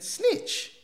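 high, breathy incredulous echo; mocking echo. Probation officer scoffing at his snitch claim, incredulous. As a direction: repeat his word back in disbelief.

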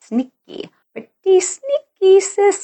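catchphrase voice. Retold or imitated. Teasing her audience about sneaky spouses, high sing-song. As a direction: quote the old ad line in a high teasing voice.

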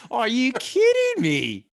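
high, loud, slow drawn-out exclamation; catchphrase voice. Retold or imitated. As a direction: stretch it like a famous catchphrase. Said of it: "we're not allowed to say that".

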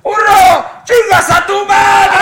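very high, very loud bellowed insult; very high, very loud slow yell of a stadium heckler; crowd heckler's yell. Retold or imitated. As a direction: scream it across a packed bullring. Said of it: "se oye un gritón".

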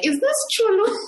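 high, lightly voiced caller unsure who answered; caller asking. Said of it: "they're like".

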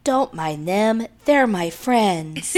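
slow eerie possessed voice; creepy movie person. Retold or imitated. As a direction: drag it out like a horror film mother. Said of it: "like a creepy person in the movie".

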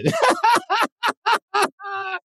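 long, high-pitched laughing fit; hearty laugh. Real, not acted. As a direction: crack up at the silly moan. Laughs at her attempted moan.

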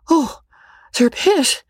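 high, overcome exclamation; overwhelmed young woman's voice; very much moved. A narrator's reading. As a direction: falter with emotion, pitch way up. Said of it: "very much moved".

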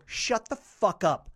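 slow, loud rebuke to a heckler; angry snap-back. Retold or imitated. Comic turning on the screaming lady, slow angry rebuke. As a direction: draw it out loudly, shutting her down. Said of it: "I said it like that".

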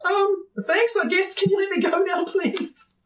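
high, timid plea; scared young wizard. Acted. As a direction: ask meekly in a high voice. Scared wizard pinned to tree.

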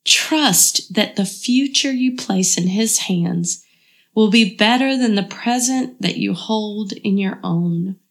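normal talking voice

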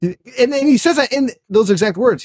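normal talking voice

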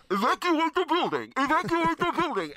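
mock evacuation alarm call; PA announcement voice. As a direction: recite it like a building PA system. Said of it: "I've got two noises".

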